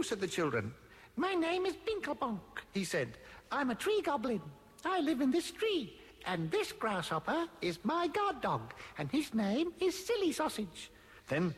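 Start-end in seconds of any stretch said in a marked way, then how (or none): 1.2-2.6 high tiny goblin voice; little tree goblin. A narrator's reading. Tree goblin telling children his name, little high voice. 3.5-10.9 high-pitched goblin introducing himself; little tree goblin. A narrator's reading. Tree goblin introducing his guard dog, little goblin voice.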